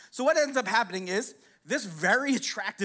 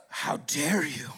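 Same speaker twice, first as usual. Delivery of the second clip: slow, low offended client voice; hammed-up wounded dignity, low and slow; mock indignation; 7 semitones lower, slower than usual. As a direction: draw it out low, acting deeply affronted. Offended client answers in a quarrel.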